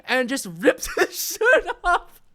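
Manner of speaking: high, laughing through the words; real laughter. Real, not acted. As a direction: barely get it out for laughing. Teller cracking up retelling.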